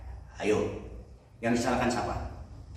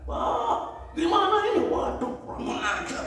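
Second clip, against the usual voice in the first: very high, put-on squealing panic; panicked shriek; 4 dB louder than usual. Traveler screaming back at the owner before the ravine, panicked shriek. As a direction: shriek in terror at the cliff edge. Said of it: "Langsung dia teriak".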